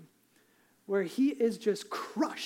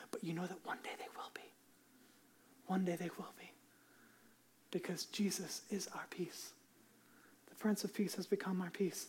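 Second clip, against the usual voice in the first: near-whispered, intimate benediction; hushed closing; 13 dB quieter than usual. Acted. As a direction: bring them down gently to peace.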